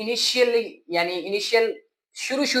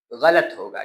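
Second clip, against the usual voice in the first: normal talking voice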